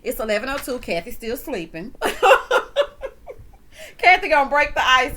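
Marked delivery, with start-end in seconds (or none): 2.0-3.9 loud, high cackle; loud high laugh. Real, not acted. Host laughing that Kathy is still asleep, big high cackle. 4.0-5.2 much louder, higher teasing line. Real, not acted. Host joking about sleepy Kathy, loud teasing. Joke after laugh.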